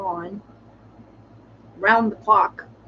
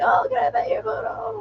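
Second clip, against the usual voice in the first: much higher, gushing mock voice; gushing stranger; 14 semitones higher than usual. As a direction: play an excited stranger squealing at the dog. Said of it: "they're like".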